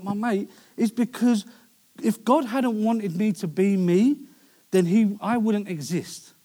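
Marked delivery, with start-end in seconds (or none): none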